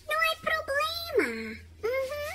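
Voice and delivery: a high, eager cat answering the neighbor; Señorita Whiskers voice. Acted. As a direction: pitch way up and answer the phone brightly. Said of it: "Y yo le respondo".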